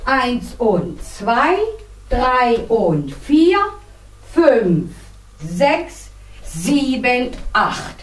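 slow, measured dance count. Shown as a demonstration. Dance teacher repeating the demonstration, slow count. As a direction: draw out each number with space between. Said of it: "Ich zeige das noch einmal".

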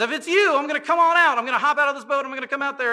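put-on a kid itching to jump out of the boat; eager Peter. Peter in the boat shouting to Jesus, excited.

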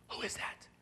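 hushed, voiceless who-is-that; whispered question. Acted. Partner whispering a puzzled question about the caller. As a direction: whisper it in alarm.

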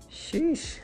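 usual speaking voice

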